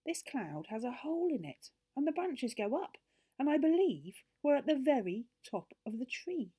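high, rising storybook wonder; amazed. A narrator's reading. Joe finds the top of the tree, amazed. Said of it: "said Joe in amazement".

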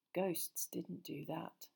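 slow, soft and grave; slowly. A narrator's reading. Harry looking at the torn chair, slow soft words. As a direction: weigh each word quietly. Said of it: "he said slowly".

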